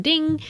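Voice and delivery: high, ringing "ding"; ringtone ding. Retold or imitated. Joking that the shot needs a ringtone, high sung-out ding. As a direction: chime it like a little notification bell. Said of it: "put a little ringtone".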